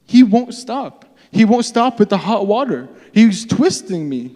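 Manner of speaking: high-pitched, acted-out grievance; distressed complaint. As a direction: complain to a friend as if hurting. Acts out complaining to a friend.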